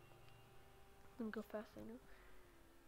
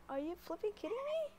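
disbelief; 9 semitones higher, faster than usual. Real, not acted. Crash in game.

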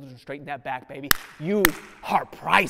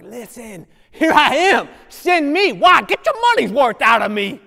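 Isaiah answering; 8 semitones higher, 11 dB louder than usual. Retold or imitated. Preacher voicing Isaiah answering God, rising to a loud cry. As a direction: burst out offering yourself. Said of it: "And Isaiah's like".

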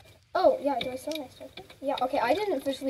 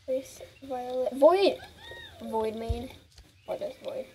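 ordinary talk

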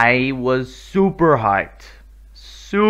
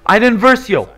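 higher, louder thrilled naming; excited gasp; 5 semitones higher, 5 dB louder than usual. Real, not acted. Gamer recognizing Iden Versio, gasping excitement. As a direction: gasp then call out. Calling out characters.

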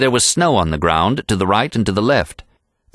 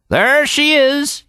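higher, slow, drawn-out announcement; old packer's yell; slower than usual. A narrator's reading. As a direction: sing it out at the first sight of the cabin. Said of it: "yelled Happy".

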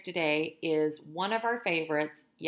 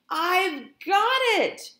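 much higher, louder, slow eureka; eager cat cries out his plan; excited discovery; 12 semitones higher, 8 dB louder, much slower than usual. Acted. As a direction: Pete shouting out his big idea. Pete's sudden idea.

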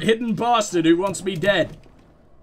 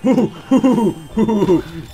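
loud laugh; 6 dB louder than usual. Real, not acted. Laughing after the shout.

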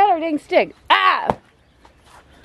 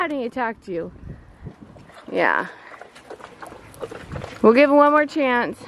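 ordinary delivery

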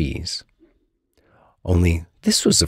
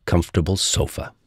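usual speaking voice

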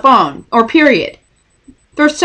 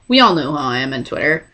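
usual speaking voice

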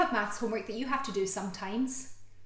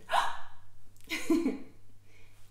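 long delighted gasp; 8 semitones higher than usual. Acted. Delight at the picture.

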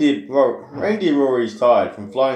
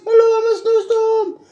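very high, louder squeaky voice with whooping; squeaky snowstorm voice; 5 dB louder than usual. Acted. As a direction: hoot like a cheeky little snowstorm. Snowstorm character voiced in book.

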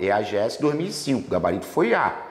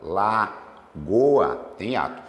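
very slow syllables; 2 semitones lower, much slower than usual. Shown as a demonstration. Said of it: "ó".

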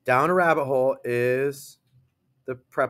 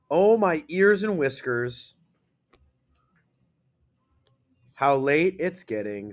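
excited read. Shown as a demonstration. Teacher rereading the rabbit's line, mildly excited. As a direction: make it an excited statement. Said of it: "You read it like that because it's excite".

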